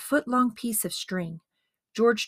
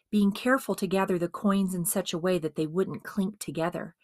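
usual speaking voice

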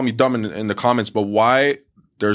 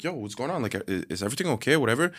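quick, much softer worried check-in; concerned hush; 8 dB quieter, faster than usual. Retold or imitated. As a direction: rush a soft, worried are-you-okay. Checking on an upset girl.

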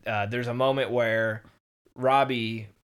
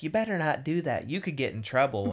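goody two-shoes; 3 semitones higher than usual. Retold or imitated. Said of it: "is like".

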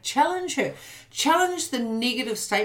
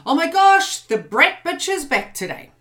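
loud, high gasp announcing the brat's back; playful teasing call-out; mock announcement; 6 semitones higher, 6 dB louder than usual. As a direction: call out the bully with mock alarm. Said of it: "I can just say".

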